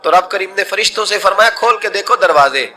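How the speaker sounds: high, full-voiced storytelling at the peak; impassioned preaching. Preacher tells the congregation of the man's true repentance, in impassioned full voice. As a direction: carry the crowd to the tearful repentance.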